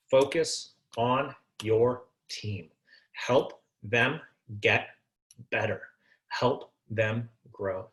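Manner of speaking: low, slow heartfelt advice; slow and sincere. Real, not acted. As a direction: lay it down gently and slowly. Slow earnest closing advice.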